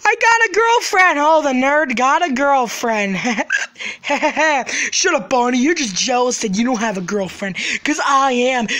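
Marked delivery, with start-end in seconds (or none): none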